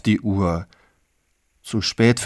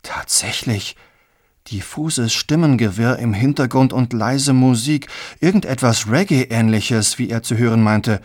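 ordinary delivery

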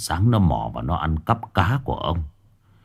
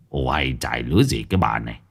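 husband's voice; 8 semitones lower, 3 dB quieter, faster than usual. Acted. Husband brushing wife off.

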